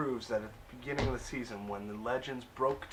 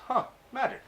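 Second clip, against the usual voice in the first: much higher, louder mocking "Huh"; mock intrigued villain; 13 semitones higher, 5 dB louder, faster than usual. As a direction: squeak it out like a smug villain discovering magic. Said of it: "Other Damien goes".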